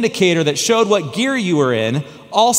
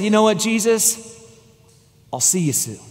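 a quiet farewell to Jesus; peaceful surrender; 2 semitones higher, 3 dB quieter than usual. Retold or imitated. Trapped man saying goodbye to Jesus, softly at peace. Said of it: "Had lived a good life".

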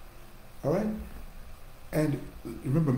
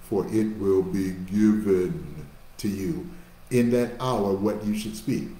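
slow, deliberate scripture reading, every word spaced; 6 semitones lower, 3 dB louder, slower than usual. Shown as a demonstration. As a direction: draw each word out so they catch it. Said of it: "I'll read it slow".

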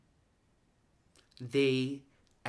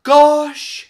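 high, loud gasp of delight; gasping gosh; 11 semitones higher, 17 dB louder than usual. Acted. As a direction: exclaim with breathless surprise. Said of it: "she gasped".

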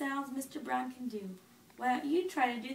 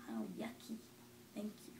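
ordinary delivery

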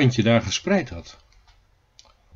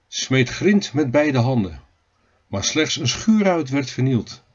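usual speaking voice

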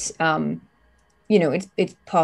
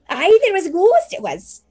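imitating maid; 14 semitones higher, 5 dB louder than usual. Player mimics maid voice.

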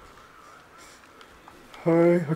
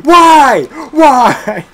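high, very loud wail; anguished yell; 11 semitones higher, 11 dB louder than usual. Real, not acted. Player lamenting a mishap to a teammate, anguished yell. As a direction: cry out at the unfairness.

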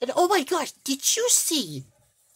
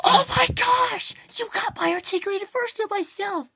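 thrilled surprise; 6 semitones higher, 4 dB louder than usual. Acted. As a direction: burst out overjoyed at the gift. Thrilled at receiving her new figure.

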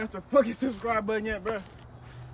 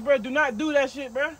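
ordinary delivery

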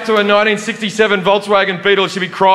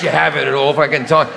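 usual speaking voice

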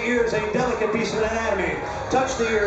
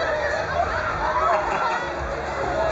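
high-pitched giggle. Real, not acted. High laugh after squeak.

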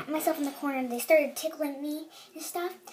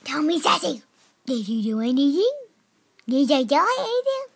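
hammed-up loud cutesy interrogation; playful pet talk; 3 semitones higher, 8 dB louder than usual. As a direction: quiz the cat, pitch swooping up at the end. Talks to cat, voices its answer.

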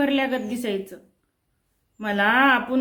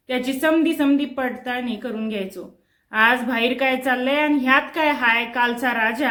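usual speaking voice

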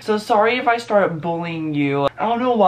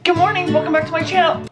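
bright vlogger intro; 10 semitones higher, faster than usual. Acted. Cheery vlog intro greeting.

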